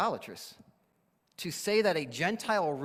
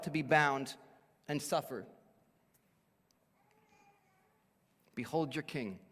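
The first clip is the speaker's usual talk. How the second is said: solemn proclamation. Pretend. Pastor presenting Jesus to the congregation, hushed and reverent. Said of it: "Pilate is speaking prophetically".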